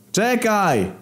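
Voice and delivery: high call after someone running off; calling after him. Acted. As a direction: shout after him to stop. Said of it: "zawołałem za nim".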